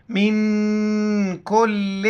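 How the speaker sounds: من کل slowly, the نون hidden in the nose; nasal ikhfa. Shown as a demonstration. Teacher demonstrating ikhfa to students, slow nasalised reading. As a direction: hide the nun in a nasal hum. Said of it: "ناک میں آواز چھپائیں گے".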